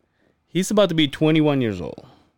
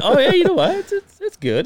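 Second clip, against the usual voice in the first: much higher, flustered and giggling; awkward laughing reply; 3 dB louder than usual. Retold or imitated. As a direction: squeak it out, embarrassed.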